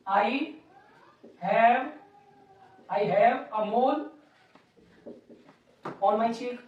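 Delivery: very slow, stretched words; slow dictation. Shown as a demonstration. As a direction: read it out as you write it. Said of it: "teacher dictates while writing". (धीरे-धीरे लिखवाते हुए)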